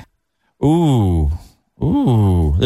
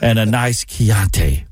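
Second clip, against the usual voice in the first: slow, creepy cannibal savouring the words; creepy connoisseur villain; Hannibal Lecter; 4 semitones lower than usual. Acted. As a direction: draw it out with relish, lower. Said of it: "stop talking in that voice".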